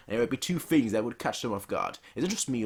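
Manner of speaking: usual speaking voice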